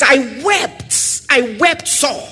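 a touch of anguished weeping; lamenting weeping. Retold or imitated. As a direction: grieve over the sealed book. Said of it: "And he says".

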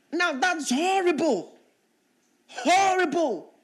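high, loud HORRIBLE; horrified emphasis. Real, not acted. Preacher to congregation, horrified emphasis on horrible. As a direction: lean hard on horrible with disgust.

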